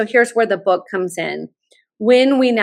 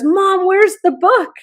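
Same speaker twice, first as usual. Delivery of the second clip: much higher, slow, playful demand; eager son; 9 semitones higher, 4 dB louder, slower than usual. Retold or imitated. Son at graduation asking mom for the book, playful higher demand. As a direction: tease her with the question.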